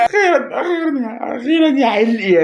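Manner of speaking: joyful outburst. Real, not acted. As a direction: burst out laughing with relief, loud. Finally pulls a player.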